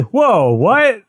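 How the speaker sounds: high, loud mock shock; shocked whoa. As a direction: jump into startled disbelief. Reacting to dog eaten by rats.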